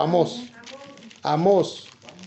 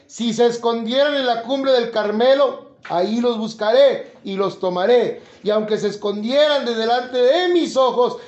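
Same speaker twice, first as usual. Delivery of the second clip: preaching scripture; 4 semitones higher, 4 dB louder than usual. Acted. Pastor reading Amós aloud to the congregation, preaching at full force. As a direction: proclaim the verses like a warning from God.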